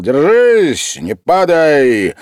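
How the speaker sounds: громко и высоко, весёлый пьяный окрик; крикнуть, поскальзываясь, «не падай» медленно; крик. A narrator's reading. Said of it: "крикнул". (студент, падая, громко кричит Васильеву)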